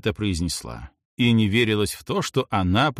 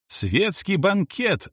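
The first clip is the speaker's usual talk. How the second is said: high, drawn-out exclamation; lightly voiced old lady crying out; old lady's mild yell; 8 semitones higher, much slower than usual. A narrator's reading. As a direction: sing out a quiz guess, slowly. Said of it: "завопила седовласая старушка".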